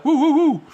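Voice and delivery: high, loud woo-woo noises; imitated siren whoops. Telling of emergency helicopter trip, mock siren whoops.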